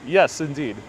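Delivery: ordinary talk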